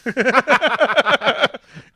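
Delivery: shrieking burst of laughter; a high, loud cackle that breaks out. Real, not acted. Telling a friend about the stained window, laughing high.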